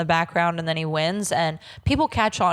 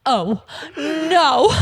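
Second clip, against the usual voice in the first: mock crying Angela; 7 semitones higher, 4 dB louder than usual. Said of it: "She's like".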